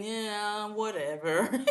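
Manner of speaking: deflated mock Eeyore with a laugh; imitating the gloomy friend brushing off praise; mopey Eeyore. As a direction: shrug it off gloomily. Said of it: "Eeyore syndrome".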